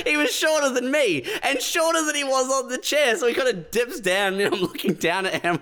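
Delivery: high-pitched, amused storytelling; gleeful disbelief. Real, not acted. Comic tells friend the big guy was shorter, gleeful disbelief.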